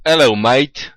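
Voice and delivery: a loud, hammed-up British "Hello, mate"; proudly showing off a practised British accent greeting. Shown as a demonstration. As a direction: greet like a cheery London cabbie, British accent. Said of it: "this is how I sound when I speak British".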